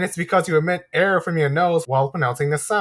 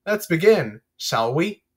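normal talking voice

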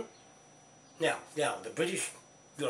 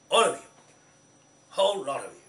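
angry shout; 3 semitones higher, 9 dB louder, faster than usual. Real, not acted. Threatening the whole lot, a loud angry yell. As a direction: spit it at every one of them.

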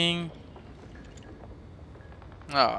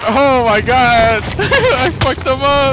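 high, loud, slow victory yell; laughing disbelief at the win; ecstatic yell; 12 semitones higher, 7 dB louder, much slower than usual. Real, not acted. After winning the fight.

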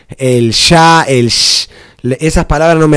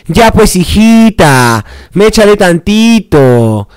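mock Peruvian accent, higher, drawn out slow; teasing Peruvian-style slang, sung out high and slow; 6 semitones higher, slower than usual. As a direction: ham up a Peruvian street drawl, slowly stretched. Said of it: "yo no andaba hablando, eh, corte".

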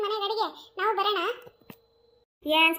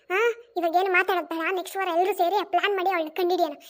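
ordinary talk, nothing marked

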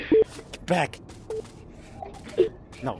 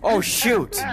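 ordinary talk, nothing marked

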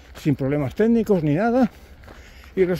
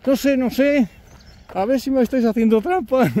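higher, mock-suspicious priest; 8 semitones higher than usual. Priest doubting the pilgrims, mock-suspicious and higher. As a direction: tease them about cheating.

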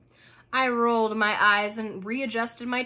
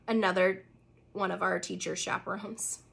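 ordinary delivery

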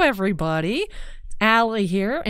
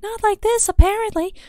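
much higher, louder mock Susato voice; Susato impression; 7 dB louder than usual. Player mimicking what Susato should sound like, Susato impression. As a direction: push the pitch way up and louder. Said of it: "what Susato's really supposed to sound like".

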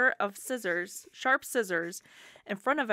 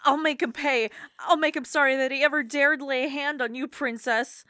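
higher, louder sworn vow; vengeful vow; 5 semitones higher, 5 dB louder than usual. Acted. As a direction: swear revenge, voice raised. Ferd vows revenge to doll.

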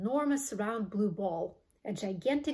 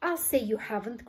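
plain reading voice

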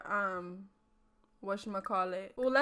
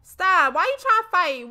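loud, higher stop, why you trying to fight; calling out; 5 semitones higher, 9 dB louder than usual. Real, not acted. Streamer yelling at two brawling customers to stop, loud. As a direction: yell at them to quit.

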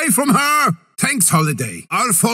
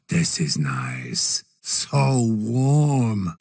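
very soft, slow, low murmur sinking to a whisper; whispered comfort; hushed contentment; 11 semitones lower, 4 dB quieter, much slower than usual. Acted. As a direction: savour the cold like a warm bath. Frozen in his ice dome.

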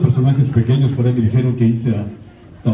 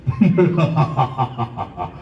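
Freezer's laugh. Acted. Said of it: "habla así".

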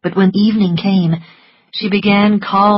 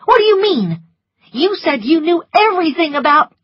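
higher, louder furious demand; angry; 11 semitones higher, 4 dB louder, faster than usual. A narrator's reading. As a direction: demand it in rage. Scarlet furious at Prissy.

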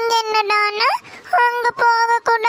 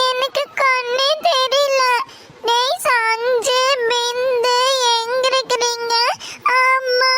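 frightened calls, higher, on the edge of tears; scared child; 3 semitones higher than usual. Acted. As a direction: cry out for mum, lost and afraid. Child lost in fog, calling parents. (பயந்த குழந்தையின் அழுகுரல்)

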